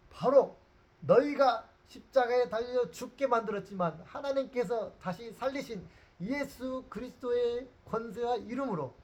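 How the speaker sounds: high, loud, pointing-finger declaration; bold accusing shout. Acted. Peter boldly accusing the council before the judges, shouting. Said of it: "이렇게 삿대질을 하면서".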